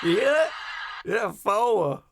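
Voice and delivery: learning to mimic Harris's accent from the clip; Southern "four" imitation. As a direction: copy her Southern twang eagerly. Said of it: "She's teaching me how to do it".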